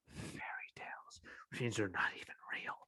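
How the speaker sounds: voiceless, quick under-the-breath remark; sarcastic whisper; whispered aside. Real, not acted.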